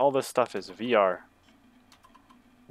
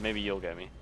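hushed and nervous; quiet worried mumble; being chased; 4 semitones lower, 9 dB quieter than usual. Real, not acted. Enemy closing in.

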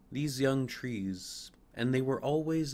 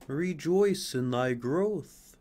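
slow, louder, glowing call; sunbeams' voice; 4 semitones higher, 6 dB louder, slower than usual. Acted. Sunbeams urging the young fir to rejoice. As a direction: sing it out like warm sunlight. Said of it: "said the sunbeams".

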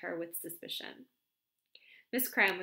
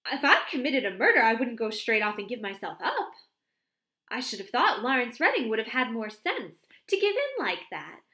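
higher, louder outraged chatter; indignant disbelief; 6 semitones higher, 8 dB louder than usual. A narrator's reading. Miss Cram scoffing at Redding's confession, indignant. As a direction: pepper him with baffled questions.

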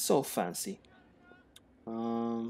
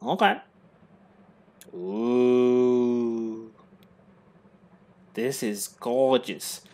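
1 posh admiration; 3 dB louder, much slower than usual. Shown as a demonstration. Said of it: "I say".

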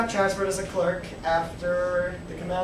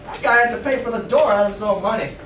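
ordinary delivery

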